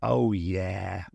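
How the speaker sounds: low, drawn-out cocky yes; knowing, winking confidence; confident assurance. A narrator's reading. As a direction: drop the pitch, utterly sure. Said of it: "with a confident wink".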